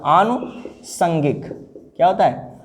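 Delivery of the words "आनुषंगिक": आनुषंगिक drawn out slowly; आनुषंगिक, slow and careful; slow dictation. Shown as a demonstration. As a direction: stress each syllable of आनुषंगिक. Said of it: "ये बहुत ध्यान दीजिएगा".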